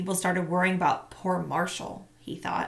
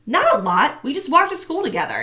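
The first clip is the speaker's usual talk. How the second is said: high, loud defensive protest; quick to deny, a bit too eager; boy protesting to the principal; 7 semitones higher, 8 dB louder than usual. Acted.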